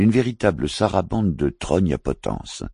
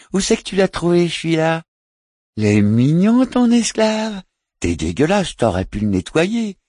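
much higher, loud streetwalker voice; teasing street hooker; 9 semitones higher, 5 dB louder than usual. Acted. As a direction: call out high and teasing. Prostitute calls out to passers.

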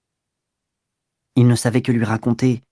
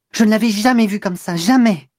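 upset; 8 semitones higher, 3 dB louder than usual. Acted. Agitated girl retelling scene.

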